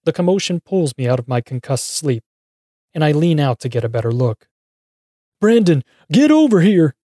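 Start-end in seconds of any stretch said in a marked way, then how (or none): 5.4-6.9 high, loud, desperate call; urgent pleading. A narrator's reading. Davy to the cowboy by the car, urgent pleading. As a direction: plead for help at a shout. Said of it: "Frog-Eyed Davy pleads".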